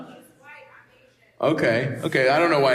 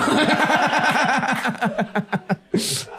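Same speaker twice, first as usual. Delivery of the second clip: a real laugh trailing off; 7 semitones higher than usual. Real, not acted. Comic laughing about the cheese joke. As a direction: let the laugh fade out.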